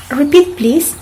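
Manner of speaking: ordinary delivery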